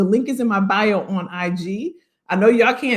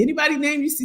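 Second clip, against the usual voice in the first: usual speaking voice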